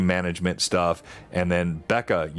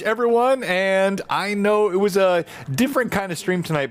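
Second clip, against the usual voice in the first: ordinary talk, nothing marked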